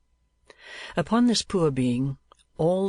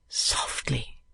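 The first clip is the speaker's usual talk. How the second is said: whisper; 4 dB quieter than usual. A narrator's reading. Nicholas hushing the boy.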